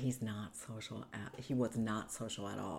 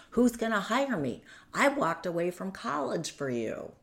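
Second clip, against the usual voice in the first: high, raised-voice retold argument; indignant blame; 7 semitones higher, 5 dB louder than usual. Retelling the quarrel over getting a job, indignant. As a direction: argue back accusingly, re-enacting the row. Partner's angry reply, acted out.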